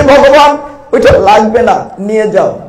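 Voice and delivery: high, loud pleading boatman; loud devotional plea; boatman's plea. Acted. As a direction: cry out the boatman's refusal to the Lord. Preacher voicing the boatman pleading.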